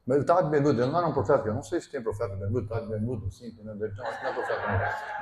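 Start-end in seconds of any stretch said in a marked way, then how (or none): 2.0-5.2 laughing. Real, not acted. Laughing at bermuda prophet.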